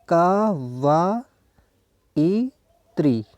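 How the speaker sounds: कवयित्री extremely slow, ka-va-i-tree; drawn-out syllables with gaps in कवयित्री. Shown as a demonstration. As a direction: pull कवयित्री apart, each syllable held long. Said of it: "उसका उच्चारण होता है".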